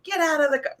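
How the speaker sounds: high-pitched mock order; rough handling. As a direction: imitate someone roughly ordering a person around. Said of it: "he kinda rough handles her".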